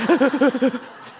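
high, rapid breathy stutter; hammed-up hyperventilation; imitated panicked gasping. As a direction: pant in mock panic. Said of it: "I'm like".

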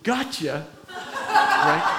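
chuckling, playful gotcha; laughing tease. Retold or imitated. The Lord teasing the doubting counselor, laughing. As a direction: let the joke land with a laugh.

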